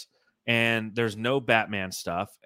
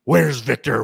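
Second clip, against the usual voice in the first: slow, louder Batman call; 2 semitones higher, 10 dB louder, much slower than usual. Pretend. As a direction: drag it out, loud, as Batman searching. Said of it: "as Batman".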